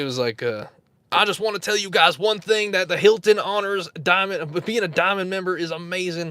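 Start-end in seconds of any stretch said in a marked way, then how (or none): 1.1-6.3 Southern hotel guy impression. Host imitating his old Southern character for the stream. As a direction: put on a Southern guy bragging about Hilton diamond. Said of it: "let me see if I could do the impression".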